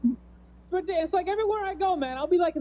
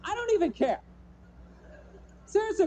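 ordinary talk

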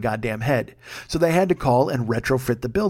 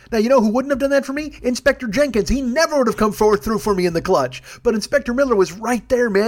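excited triumph; 8 semitones higher than usual. Real, not acted. Telling listeners about the carpet win, elated and high. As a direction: gush with pure triumph.

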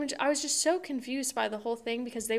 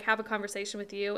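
ordinary talk

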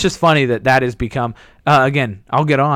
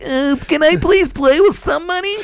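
much higher timid plea; whiny pleading kid; 13 semitones higher than usual. Retold or imitated. Bad player begging teammates, high whiny plea. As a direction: beg meekly like a small kid. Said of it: "I'm like".

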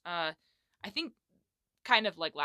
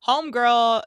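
a big loud greeting, homegirl; loud call; 6 semitones higher, 15 dB louder than usual. Acted. Host calls out homegirl to listeners, loud and bright. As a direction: holler it out.

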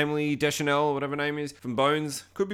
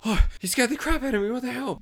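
high startled outburst; hammed-up shock, pitched up; mock fright; 10 semitones higher than usual. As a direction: react as if just made to jump. Acts out startled man at door.

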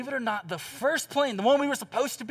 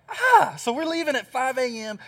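exasperated cry; 5 semitones higher than usual. Real, not acted. Exclaiming at early flight.

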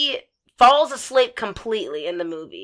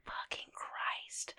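breathy whisper under the breath; a whispered groan of dread; whispered curse; 27 dB quieter, much slower than usual. Retold or imitated. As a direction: mutter it to yourself in dread.

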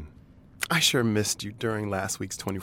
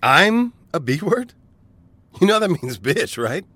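offended surprise; 8 semitones higher, 5 dB louder than usual. Real, not acted. Offended reaction.

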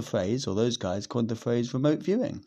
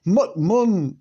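mock Scottish; 7 semitones higher, 6 dB louder than usual. Shown as a demonstration. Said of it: "That sounds Scottish".

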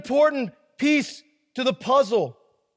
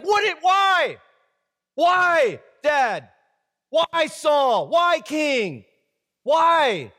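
pleading shout; 3 semitones higher, much slower than usual. Acted. Jonathan protesting to his father, shouting and pleading. Acted Jonathan arguing with Saul.

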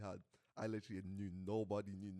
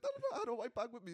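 high squeaky panicked voice; high panicked quote; 13 semitones higher, 4 dB louder, faster than usual. Retold or imitated. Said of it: "He's like".

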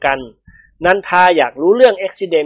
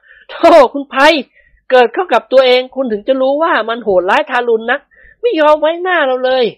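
raised-pitch male character voice; good-humoured protesting man; 8 semitones higher than usual. A narrator's reading. Man defends his accident to teasing Praphai, good-humoured protest. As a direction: protest in a higher, lively voice.

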